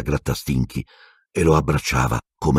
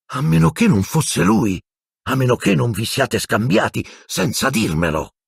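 high-pitched, dawning astonishment; startled realisation; 9 semitones higher than usual. A narrator's reading. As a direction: let the realisation lift the voice high. Lupin's sudden startled realisation.

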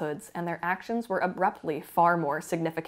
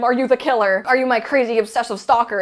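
loud, higher, intense; mock-serious louder interrogation; dramatic mock question; 4 semitones higher, 7 dB louder, faster than usual. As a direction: build it up like a big accusation. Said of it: "he thinks he's gonna ask him".

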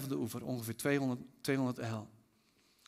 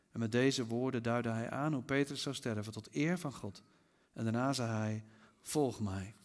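lower, softer reverent reading; solemn scripture reading; 4 semitones lower, 6 dB quieter than usual. A narrator's reading. Jesus tells Peter how his life will end, read solemnly. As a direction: read the prophecy gently and gravely.

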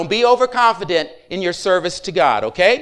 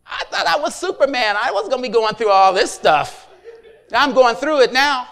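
laughing; 2 semitones higher than usual. Retold or imitated. Laughing at himself, retold.

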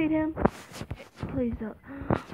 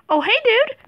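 startled; 11 semitones higher, 7 dB louder than usual. Real, not acted. Startled exclamation.

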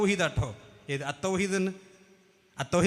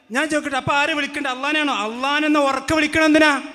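higher and louder, quickening rhetorical questions; indignant challenge; 5 semitones higher, 4 dB louder than usual. Real, not acted. Preacher challenges the rival scholar's defender, indignant. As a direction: press the opponent hard with each question.

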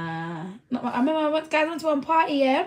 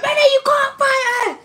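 high, loud, slowed plea to stop; retold shout; 11 semitones higher, 4 dB louder than usual. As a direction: call out to stop the fight. Friend stopping a hotel fight.

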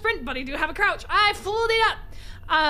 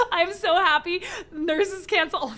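normal talking voice

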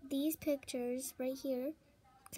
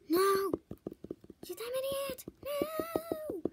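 high panicked shriek; shrieking in terror at the scare; terrified scream; 13 semitones higher, 7 dB louder, much slower than usual. Real, not acted. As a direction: scream in real fright. Jump scare in horror game.

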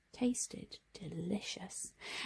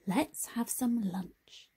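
slow Tom; slower than usual. Acted. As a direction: take your time, calm and kind.

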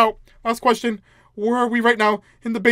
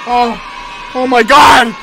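loud, higher burst of alarm; startled shout; 5 semitones higher, 11 dB louder, slower than usual. Real, not acted. Player startled by the game, startled shout. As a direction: shout it out in shock.

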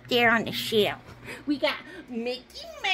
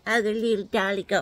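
ordinary talk, nothing marked

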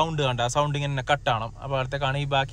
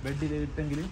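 hushed; 6 dB quieter than usual. Real, not acted. Baby asleep in car.

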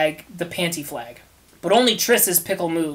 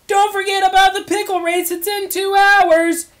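wailing high shout; screaming; 14 semitones higher, 7 dB louder than usual. Acted. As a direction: scream the reminder across hell. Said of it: "he screamed".

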